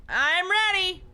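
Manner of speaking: put-on excited young hero hailing grandpa; calling out. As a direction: shout it up to Gramps like an excited boy. Hero voice calls back.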